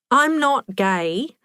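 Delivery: ordinary delivery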